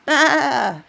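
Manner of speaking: high, bleating baa from a wolf posing as a sheep. Acted. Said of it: "he bleated".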